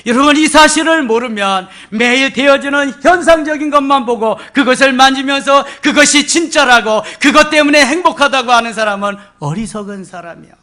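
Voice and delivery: loud, high, ringing preacher's cry; fervent sermon climax; preaching at full force. Acted. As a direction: proclaim it to the whole hall.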